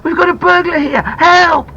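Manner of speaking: loud high cry for help; shouting for help. A narrator's reading. Boy calling out the window about a burglar, shouted. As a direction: yell it out of the window. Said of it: "he shouted".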